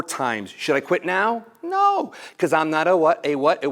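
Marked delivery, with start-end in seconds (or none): 1.6-2.1 high squeak. Acted. Answering his own question.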